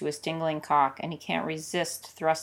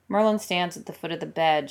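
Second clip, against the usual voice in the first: ordinary delivery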